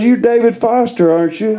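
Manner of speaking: high mock certainty; lightly voiced eager guess. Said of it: "He goes".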